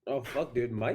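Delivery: ordinary delivery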